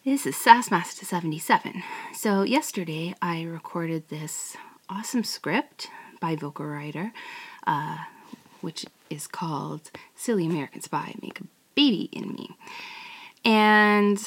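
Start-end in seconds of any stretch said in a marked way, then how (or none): 2.1-12.6 soft whispered intro; whispering. Real, not acted. Whispering to listeners about yesterday's recording. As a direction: whisper the setup close to the mic. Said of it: "unvoiced whisper".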